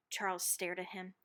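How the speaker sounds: breathy hush as if not to be overheard; suspenseful whisper. A narrator's reading. Narrator reading to children, building suspense in a whisper. Suspense as Charles watches patient.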